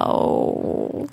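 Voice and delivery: breathy whispered hello, no voice at all; whispered hello to an otter. Pretend. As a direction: hush it like greeting a shy animal. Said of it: "I would just say hello".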